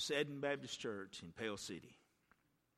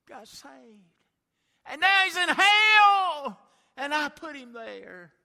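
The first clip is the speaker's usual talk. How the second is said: anguished wail; 19 dB louder, slower than usual. Acted. Mother's anguished confession.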